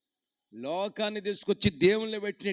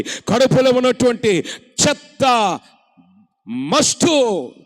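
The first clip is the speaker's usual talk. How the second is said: growing louder and higher with each line; building preaching; 4 semitones higher, 18 dB louder than usual. Acted. Preacher promising healing to listeners, building loud.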